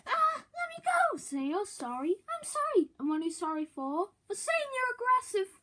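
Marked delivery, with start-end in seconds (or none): 0.1-1.0 high, pained plea to be let go; pained squeal. Acted. Pinned down, begging release. 2.2-2.9 high, strained forced "sorry"; squeaky forced apology. Acted. Forced apology while pinned. 4.3-5.5 high, strained forced "sorry"; squeaky forced apology. Acted. Forced apology while pinned.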